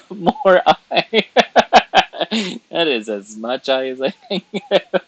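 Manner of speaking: high, loud, helpless laughter through the words; giggling hard as you talk; laughing. Real, not acted. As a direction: crack up while protesting. Cracks up at pronunciation hint.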